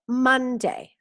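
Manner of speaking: Monday drawn out slowly, falling; teacher saying a word slowly for repetition; slow model. Shown as a demonstration. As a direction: stretch Monday out slowly for them to repeat. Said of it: "Repetir conmigo".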